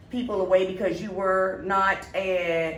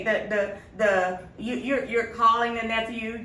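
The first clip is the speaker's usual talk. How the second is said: usual speaking voice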